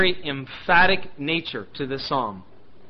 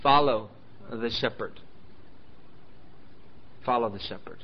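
softly repeated, dropping lower; quiet answer; 6 semitones lower, 8 dB quieter than usual. Shown as a demonstration. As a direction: lay it down gently, softer the second time. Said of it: "remember what a sheep is to do".

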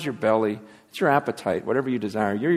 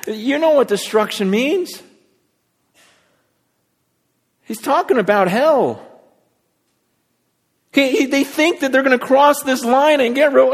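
raised pitch, urgent pulpit delivery; forceful preaching; 12 semitones higher, 6 dB louder than usual. Real, not acted. Preacher confronts the congregation about their walk, urgent preaching. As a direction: press the warning home insistently.

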